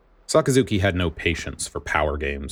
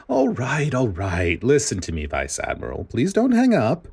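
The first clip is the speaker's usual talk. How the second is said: sugary voice; 7 semitones higher than usual. Acted. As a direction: coax him sweetly so he won't hang up. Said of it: "Spandine's voice was sugary this time".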